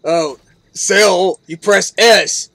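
loud, sarcastic mock explanation; mocking game designer.

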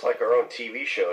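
ordinary talk, nothing marked

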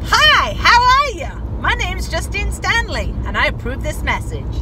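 mock American campaign-ad accent; overdone American accent. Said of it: "No, that's not".

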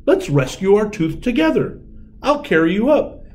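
high, keen proposal; rally your new friend; eager offer. A narrator's reading. Tooth Fairy offering to carry the mouse, eager.

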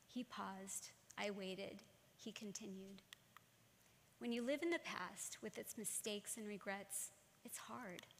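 soft, unhurried poetic recital with pauses; poem reading. Acted. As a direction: let each line land quietly. Reads a poem aloud.

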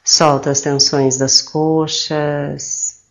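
slow, soothing meditation voice with drawn-out phrase endings; calm, slow guidance with long pauses; guided meditation. Acted. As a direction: guide them gently into relaxation, unhurried.

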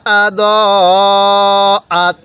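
slow, drawn-out tajweed chanting; teacher reciting slowly for students to copy; Quran recitation. Shown as a demonstration. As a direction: recite each syllable long and even. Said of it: "তাহলে কিভাবে পড়ব?".

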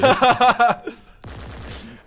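high, loud burst of laughter; sudden loud cackle of laughter. Real, not acted. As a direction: crack up loudly.

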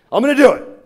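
loud, high imitated burst of resolve; all fired up. Smoker commits to calling his daughters, all fired up. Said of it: "He gets all fired up".

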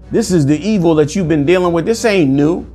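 ordinary talk

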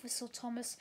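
ordinary delivery, nothing marked

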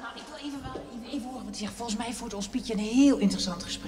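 very soft eavesdropping whisper; hush, trying to listen in. Acted. Eavesdropping at the door.

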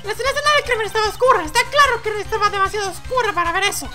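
very high put-on character voice; insistent objector. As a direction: insist in a squeaky voice. Personaje objeta, voz aguda. (objeción insistente con voz muy aguda)

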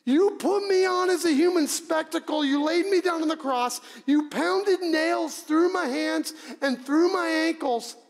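loud, high, pounding list of wrongs; outraged accusation. Acted. Preacher as the crucified man accusing his executioners, shouting in outrage. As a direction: hurl each charge at full voice.

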